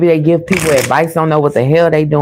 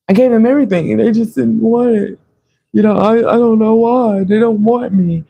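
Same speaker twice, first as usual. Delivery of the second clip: higher voiced, mock-wounded complaining ex; whiny self-pity; 6 semitones higher than usual. Imitating the ex telling people they were unappreciated, mock self-pity. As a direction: play the hard-done-by victim, lightly. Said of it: "they're telling people you didn't appreciate them".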